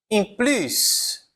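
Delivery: ordinary delivery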